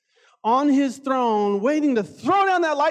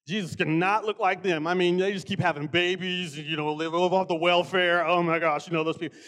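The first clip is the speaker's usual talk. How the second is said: mock prejudiced churchgoer; 6 semitones lower than usual. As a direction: sneer it like a smug bigot, only lightly put on.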